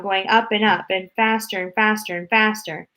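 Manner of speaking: plain reading voice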